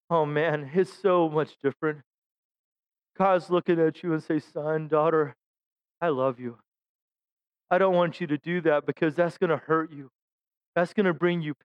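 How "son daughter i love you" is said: low, soft and tender; tender voice of God. Retold or imitated. God tells his child he loves them, low and tender. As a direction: speak as a loving father, gently.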